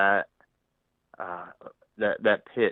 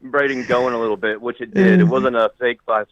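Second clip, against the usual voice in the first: laughing; 4 semitones higher, 8 dB louder than usual. Real, not acted. Admits the prank review, laughing.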